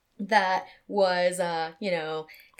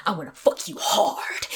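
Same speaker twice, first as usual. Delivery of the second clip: whispered menacing line; menacing client; 5 dB louder than usual. Retold or imitated. Burly client leaning into her face, whispered menace. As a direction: breathe it like a looming threat. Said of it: "he's, like, stomping into the room".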